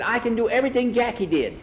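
put-on high, insistent boy's voice; earnest kid persuading an adult; eager pleading. Skeeter pleads to be allowed riding.

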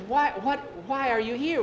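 high, loud, stumbling question; flustered, pitched well up; baffled questioning. Acted. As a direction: stumble over it in acted amazement. Boy baffled by the stranger.

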